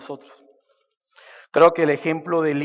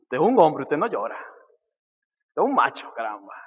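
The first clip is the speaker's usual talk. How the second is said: mock stern father. Father telling his crying son to be a man, mock stern. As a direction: scold the crying boy like a tough father. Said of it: "qué le dice el papá".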